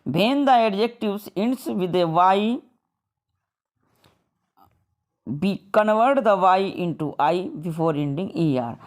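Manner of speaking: slow, deliberate reading of a textbook rule; slow rule dictation. Shown as a demonstration. Teacher reading a grammar rule to students, slow and deliberate. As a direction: spell the rule out slowly for the class. Reads English rule aloud slowly.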